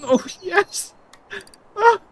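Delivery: high, gleeful yes; high excited cheer; joyful cheer. Real, not acted. Joyful reaction.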